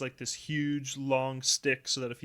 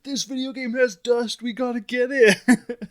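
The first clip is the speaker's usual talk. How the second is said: put-on high, loud, excited little boy; excited kid voice; 10 semitones higher, 9 dB louder than usual. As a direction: gush like a thrilled kid. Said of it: "he's like".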